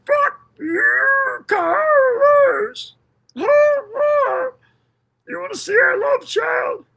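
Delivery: high, slow crying mock yell; mock shout. Mocking her outburst at Carlos.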